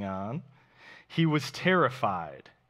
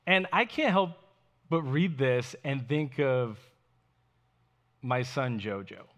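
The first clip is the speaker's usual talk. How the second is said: normal talking voice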